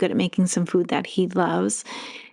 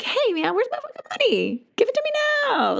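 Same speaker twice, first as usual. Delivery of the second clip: playful, much higher silly voice; having fun. Shown as a demonstration. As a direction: goof around and demand it gleefully. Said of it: "Like, having fun with it".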